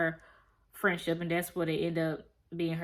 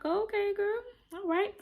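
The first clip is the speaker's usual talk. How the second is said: much higher, airy surprised reply; mock surprise; 11 semitones higher, 4 dB quieter than usual. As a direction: caught off guard, playing along. Friends react to early graduation.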